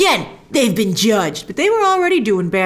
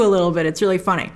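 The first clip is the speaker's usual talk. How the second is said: normal talking voice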